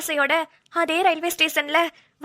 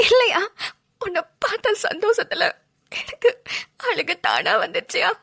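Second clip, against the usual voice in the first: sobbing. Acted. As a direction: sob out the protest, overcome. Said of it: "தேம்பி தேம்பி அழுவுறவ". (அழுகையுடன் மறுப்பு)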